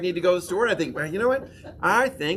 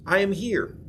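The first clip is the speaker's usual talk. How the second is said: call and response declaration; 4 semitones higher than usual. Shown as a demonstration. Pastor leading the congregation in a call and response, firm declaration. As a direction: say each word firmly, leading the room. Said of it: "So say it with me".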